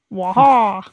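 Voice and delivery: growled cartoon villain wah; Wario wah.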